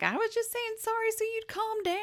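very high mock-crazed screech; Nancy gone crazy. Nancy grabbing the knife after apologising, mock-crazed screech. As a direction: screech it high, hammed-up and unhinged. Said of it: "Nancy goes crazy and grabs the knife".

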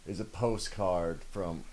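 off mic, distant; speaking away from the microphone; far from mic. Real, not acted. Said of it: "I'm gonna step away from the mic".

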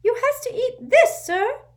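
urgent little servant squeaking; an anxious house elf waking his master; squeaky house elf. A narrator's reading. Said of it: "squeaked the elf".

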